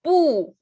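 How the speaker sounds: "bu" in a steep falling tone; fourth tone. Shown as a demonstration. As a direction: start "bu" high and drop it. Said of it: "con el cuarto tono".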